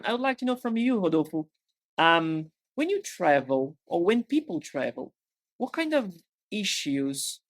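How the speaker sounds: usual speaking voice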